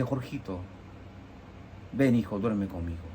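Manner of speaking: mother calling her son to sleep beside her, tender and soft. Retold or imitated. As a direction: coax him gently to come sleep. Mother calls child to bed.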